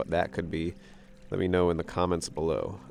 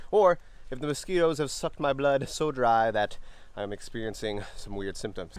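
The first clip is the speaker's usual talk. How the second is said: ordinary delivery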